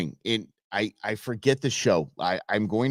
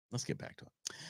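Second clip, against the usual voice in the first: whispered; 20 dB quieter than usual. Real, not acted. Whispered aside.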